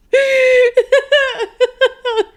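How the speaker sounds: squealing laughter; high breathless laugh. Real, not acted. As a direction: laugh till it hurts. Laughing fit.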